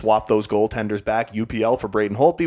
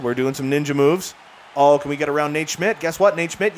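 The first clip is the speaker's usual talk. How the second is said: normal talking voice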